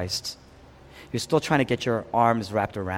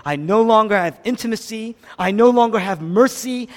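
raised, high-pitched lament; loud cry of someone abandoned; 9 semitones higher, 8 dB louder than usual. Acted. Preacher voices Jesus left for dead, crying out. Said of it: "As I'm crying out".

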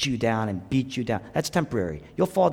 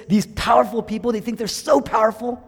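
higher, louder scornful voice of God; mocking the powerful; 9 semitones higher, 4 dB louder than usual. Preacher voicing God scorning the proud. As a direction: sneer at the proud. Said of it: "He says".

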